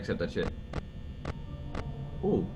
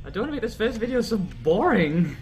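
ordinary talk, nothing marked

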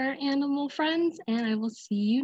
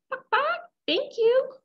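very high clucks and squeaky thanks; happy little hen; grateful chicken; 12 semitones higher, 3 dB louder than usual. Acted.

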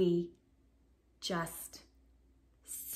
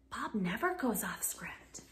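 breathless shocked whisper; worried whisper; 3 semitones lower, 9 dB quieter than usual. Acted. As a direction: whisper it like a scandal. Shocked aside about Bob.

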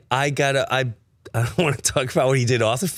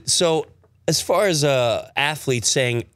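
usual speaking voice

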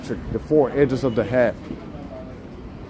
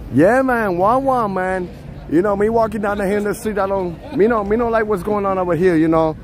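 fake Jamaican accent; 3 semitones higher than usual. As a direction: put on a playful Jamaican accent, trolling. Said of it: "I should try to speak Jamaican".

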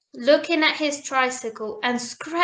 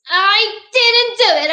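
put-on high, loud child's protest; storybook girl protesting; little princess; 6 semitones higher, 5 dB louder than usual. A narrator's reading. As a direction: insist you are innocent, pitched up. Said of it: "said the little princess".

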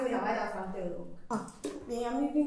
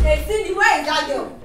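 loud, raised-pitch demand; angry calling; 5 semitones higher, 8 dB louder than usual. Acted. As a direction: storm in shouting for someone. Hunting for Cindy.

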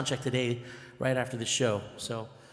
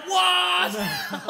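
shocked what; 6 dB louder than usual. Retold or imitated. Stunned at being recognized.

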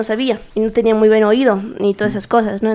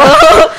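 laugh; 7 dB louder than usual. Real, not acted. Burst of laughter.

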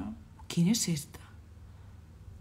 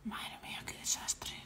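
dismayed breathy whisper, much softer; 7 dB quieter than usual. Real, not acted. Player looking over the gamer's mess, dismayed whisper. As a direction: sigh it out under the breath.

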